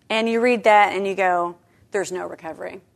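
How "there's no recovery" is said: soft, heavy "There's no recovery"; quiet despair. Retold or imitated. Voicing the reader's reaction to adultery, quiet despair. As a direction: say it low and hopeless. First take of the repeat.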